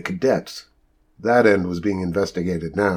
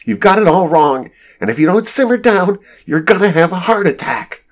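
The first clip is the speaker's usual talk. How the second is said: high-pitched, raised warning; exasperated scolding; 9 semitones higher, 3 dB louder than usual. A narrator's reading. Rachel tells Casey to simmer down, in high exasperation. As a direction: scold urgently to calm the ranter down.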